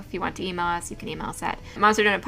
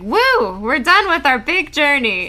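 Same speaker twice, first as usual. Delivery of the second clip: excited cheer; 6 semitones higher, 6 dB louder than usual. Retold or imitated. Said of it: "Leo and Calypso are like".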